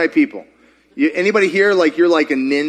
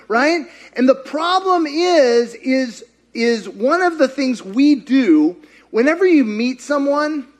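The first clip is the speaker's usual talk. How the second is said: normal talking voice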